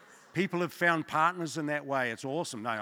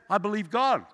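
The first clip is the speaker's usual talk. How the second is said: bold declaration; 6 semitones higher, 4 dB louder than usual. Retold or imitated. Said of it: "He goes".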